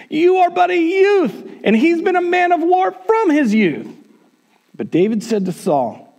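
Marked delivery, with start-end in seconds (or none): 0.1-3.9 very high, incredulous objection; acted king scoffing at a mere youth. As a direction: object in high-pitched disbelief. Saul scoffs at young David.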